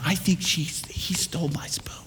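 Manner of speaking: hushed whispered suspicion. Retold or imitated. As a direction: whisper it so the pastor can't hear. Wife whispers at dinner.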